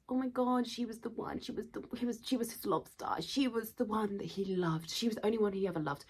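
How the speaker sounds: high, soft mock-swooning voice; gushing mimicry. Mimics gushing popular opinion.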